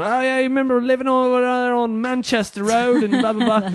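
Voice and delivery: high-pitched hammed-up British accent; fake British accent. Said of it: "our fake British accents".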